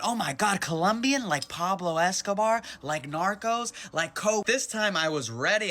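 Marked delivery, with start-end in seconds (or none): 0.0-4.5 higher, louder gushing questions. Retold or imitated. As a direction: pile on the clichés like excited girls. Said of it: "they start going".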